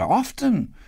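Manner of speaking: put-on high-pitched eager boy; eager boyish cry; Peter. A narrator's reading. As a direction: pipe up keenly. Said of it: "cried Peter".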